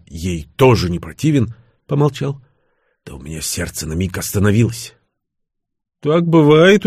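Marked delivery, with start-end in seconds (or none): 6.0-6.9 high, loud amazed question; surprised. A narrator's reading. Son hears of father's love at first sight, surprised. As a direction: marvel at it, pitch lifted. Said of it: "удивился я".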